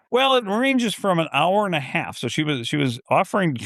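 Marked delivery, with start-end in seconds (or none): 0.1-2.2 mock agent. Agent quoted, mocked slow high.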